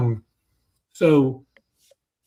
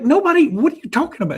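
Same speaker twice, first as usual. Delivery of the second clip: much higher, fast, baffled; exasperated dad; 13 semitones higher, faster than usual. Retold or imitated. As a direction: blurt it out in disbelief. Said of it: "I was like".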